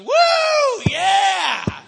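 very high, loud whoop; imitated ecstatic cheering. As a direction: whoop and yell like an overjoyed worshipper. Imitates worship leader's ecstatic cheering.

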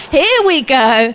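loud, drawn-out aside of delight; acted-out inward cheer; gleeful anticipation. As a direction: stretch it out loudly, relishing the opening. Repeats the gleeful inward thought.

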